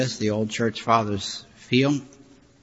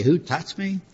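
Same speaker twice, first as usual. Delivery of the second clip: rising, disbelieving question; the apostles doubting Jesus amid the crowd, incredulous; 6 semitones higher than usual. Retold or imitated. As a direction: ask it baffled, as if the crowd makes it absurd. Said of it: "Even the apostles said".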